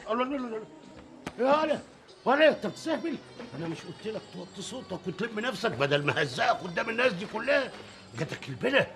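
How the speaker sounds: father shouting down his son; angry scolding. Acted. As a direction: put the son in his place.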